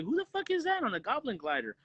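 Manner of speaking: high-pitched mock bafflement; baffled reaction. Viewer seeing the green mask and goggles, baffled. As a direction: act out incredulous confusion, voice jumping up. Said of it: "I'm like".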